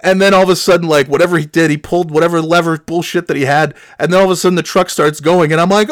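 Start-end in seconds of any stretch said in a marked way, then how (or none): none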